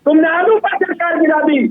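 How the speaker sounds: high-pitched, indignant accusation; angry. Real, not acted. Spokesman blaming opponents for toppling governments, angry. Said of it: "क्यों इतना गुस्सा हो रहे हो".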